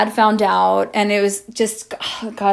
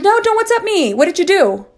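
high, raised-voice retort; retold outraged demand; indignant confrontation; 10 semitones higher, 4 dB louder than usual. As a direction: snap back at him, pitched way up.

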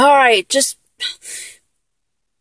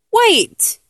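ordinary delivery

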